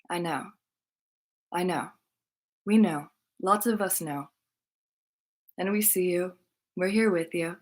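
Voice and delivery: soft, hushed consoling voice with long pauses; gentle reassurance. Retold or imitated. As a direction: softly let them know they are understood. Consoling a crying woman.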